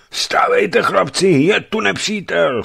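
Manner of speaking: loud, very high cry of alarm; alarmed shout. A narrator's reading. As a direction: shout to rouse the men to danger. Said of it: "chtěl vykřiknout Savelij". (poplašný výkřik)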